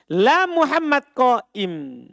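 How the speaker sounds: slow, deliberate reading of the example; measured classroom recitation; slow demo. Shown as a demonstration. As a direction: spell out the Arabic sentence for students.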